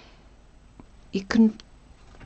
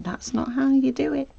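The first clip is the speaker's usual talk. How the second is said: higher, louder, mock scolding of an imagined critic; mock viewer scolding; 9 semitones higher, 6 dB louder than usual. Viewers shouting at the sewing tutor, high mock scold. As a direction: play the viewers heckling the screen. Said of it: "People are shouting at me".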